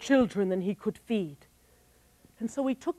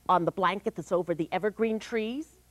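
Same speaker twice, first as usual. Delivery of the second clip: ordinary delivery, nothing marked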